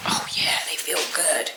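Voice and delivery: whispered mock "they feel good"; sarcastic whisper. Vlogger mocking tattoo lovers in a whisper. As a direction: whisper it with irony. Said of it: "to be like".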